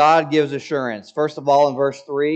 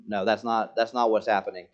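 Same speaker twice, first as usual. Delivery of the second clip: ordinary talk, nothing marked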